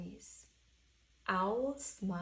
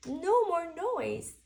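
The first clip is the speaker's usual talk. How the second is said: lightly voiced Owl, higher and louder; Owl satisfied; 7 semitones higher, 8 dB louder than usual. Owl after taking the roof down, satisfied.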